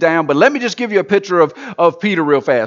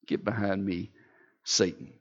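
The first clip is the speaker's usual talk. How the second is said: very low, very soft rebuke; hushed rebuke; 10 semitones lower, 13 dB quieter than usual. Acted. Jesus rebuking Peter, hushed and low. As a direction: breathe it out coldly.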